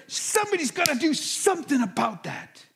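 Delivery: fired up. Retold or imitated. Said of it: "fired up".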